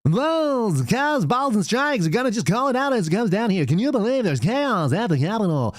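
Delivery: high, hammed-up TV anchor voice; mock newscaster. Parodying a cable news anchor in a high put-on voice.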